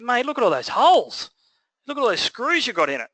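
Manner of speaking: high-pitched mock dismay, swooping on key words; retold exasperation, pitched right up; exasperated plasterer. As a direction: imitate an appalled tradie complaining. Retold plasterer complaining about the job.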